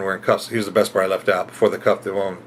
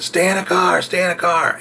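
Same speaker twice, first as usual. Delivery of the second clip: high, commanding stay in the car; mock cop yell; 7 semitones higher than usual. Police ordering the woman back in, mock yell. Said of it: "the cops yell at her".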